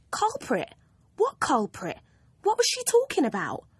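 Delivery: put-on high, baffled girl's questions; confused disbelief, thinking aloud; bewildered girl. Girl puzzled by the teacher's accusation, high and baffled.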